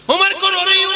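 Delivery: high, loud anguished lament; wailing widow's cry. Shown as a demonstration. Preacher acting the widow weeping for Umar, loud anguished wail. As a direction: let the widow's grief burst out. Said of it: "دھیان سے سننا".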